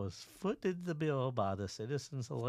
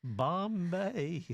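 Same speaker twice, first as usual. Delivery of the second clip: playful echo; 7 semitones higher, 5 dB louder than usual. Pretend. Echoing the place name playfully.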